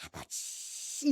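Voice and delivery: slow, soft whispered call; inner whisper. Retold or imitated. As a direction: breathe the word to yourself. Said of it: "속으로". (속삭이듯 부르는 아버지)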